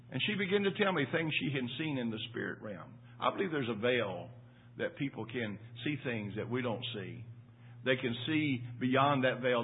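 usual speaking voice

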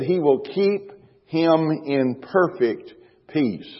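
slow, weighty stress on "perfect"; emphatic scripture quote. Real, not acted. Preacher quoting Isaiah's promise to the congregation, slow emphasis on "perfect". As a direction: draw out "perfect" slowly, then set "peace" apart. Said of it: "I want to emphasize that word perfect".

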